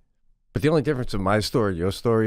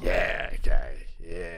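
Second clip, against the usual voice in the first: flat unimpressed; 7 semitones lower, much slower than usual. Retold or imitated. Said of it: "I'm like".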